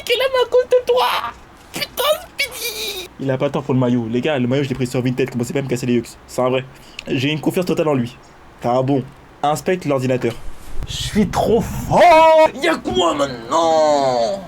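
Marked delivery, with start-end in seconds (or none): 0.0-3.1 squeaky high falsetto outburst; a cartoonish high-pitched protest; mock outrage. Real, not acted. Reacting to insulting chat message. 10.9-14.4 hammed-up triumphant show-off; gloating. Boasting after fixing computer.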